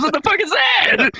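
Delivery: very high alarmed squeal; hammed-up high-pitched panic; mock panicked buddy. Acted panicked buddy hiding.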